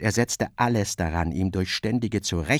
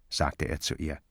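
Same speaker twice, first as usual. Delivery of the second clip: usual speaking voice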